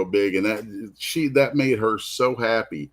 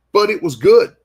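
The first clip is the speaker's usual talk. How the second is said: high and loud with amazement; excited; 11 semitones higher, 8 dB louder than usual. Real, not acted. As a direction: blurt it out, thrilled. Amazed at the girl's drawing.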